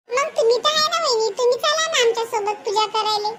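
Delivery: put-on high, eager child voice; high-pitched girlish messenger; young girl character. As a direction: chirp the invitation like a little girl. Child character urges her to come.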